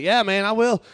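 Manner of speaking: a hint of the keen tourist. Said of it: "He's like".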